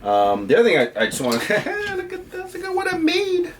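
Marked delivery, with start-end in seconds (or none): 1.4-3.5 high squeaky giggle; laughing. Real, not acted. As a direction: giggle through the words, way up high. Showing off homemade gadget.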